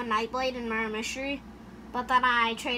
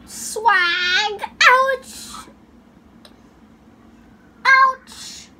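loud squeaky yelps; 11 semitones higher, 10 dB louder, much slower than usual. Pretend. Excited shouts while playing.

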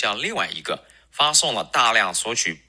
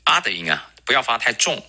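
ordinary talk, nothing marked

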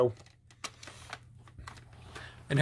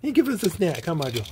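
ordinary talk, nothing marked

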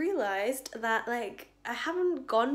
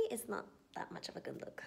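whisper; 12 dB quieter, faster than usual. Real, not acted.

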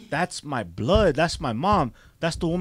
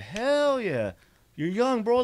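higher, louder burst of eager agreement; eager yes; 8 semitones higher, 3 dB louder, slower than usual. Retold or imitated. A boy eagerly accepting his real mom's offer to move in, excited. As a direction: jump at the offer, thrilled.

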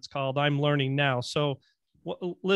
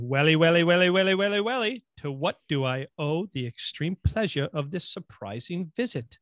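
rising run of repeats, then theatrical politeness; mock-grand film villain quote; Clockwork Orange impression. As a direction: build the opening repeats upward, then settle into a mannered greeting. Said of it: "Clockwork Orange, man. Remember Clockwork Orange?".

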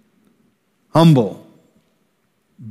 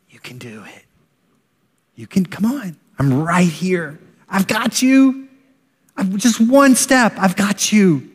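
gentle encouragement; 5 semitones higher than usual. Retold or imitated. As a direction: whisper reassurance to a child learning to walk. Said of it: "hear that voice".

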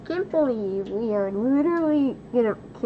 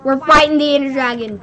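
louder, punching fighting; loud hyped take; 2 semitones higher, 8 dB louder than usual. Shown as a demonstration. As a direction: announce it big to the viewers. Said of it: "I sound like a what?".

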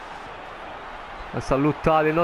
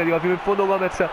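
ordinary delivery

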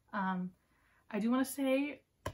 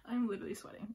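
quick, giggly confession; flustered laughing; 2 semitones higher, faster than usual. Real, not acted. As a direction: laugh through it, overwhelmed. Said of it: "I'm sweating right now".

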